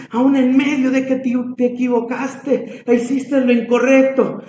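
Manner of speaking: fervent preacher's appeal; God's impassioned pleading. Acted. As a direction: plead as a wounded, loving God. Preacher voices God pleading with Ephraim.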